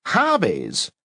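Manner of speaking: scoffing again, high and falling; disdainful repeat; contemptuous repeat. A narrator's reading. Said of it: "snort contemptuously".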